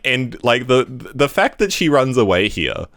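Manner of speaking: ordinary talk, nothing marked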